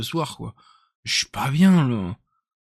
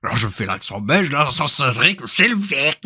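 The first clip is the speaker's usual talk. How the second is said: put-on Belgian accent. Streamer copying someone who cracked them up, Belgian accent. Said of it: "Il m'a tué quand il a fait l'accent".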